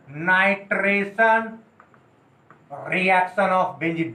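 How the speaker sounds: very slow, each word spaced out; teacher dictates the topic name for notebooks; slow dictation. Shown as a demonstration. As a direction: spell out the heading slowly for the class to copy. Teacher dictating heading for notes.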